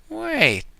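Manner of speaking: startled squawk; sudden objection. Acted. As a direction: catch yourself mid-thought. Donald objecting.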